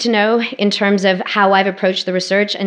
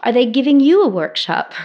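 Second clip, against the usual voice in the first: mock family reply; 5 semitones higher, 4 dB louder than usual. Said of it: "Their collective global response was".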